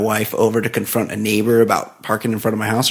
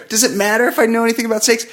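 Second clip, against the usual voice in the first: quick, much higher put-on lady voice; fast high mock woman; 11 semitones higher, faster than usual. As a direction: rattle it off like the flustered neighbor. Mocking the woman's roundabout ask.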